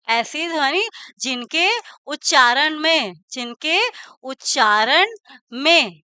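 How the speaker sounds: measured words with long gaps; slow dictation. Shown as a demonstration. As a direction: leave time to write each piece. Teacher dictates second definition for notes. (धीरे-धीरे, ठहर-ठहरकर लिखवाते हुए)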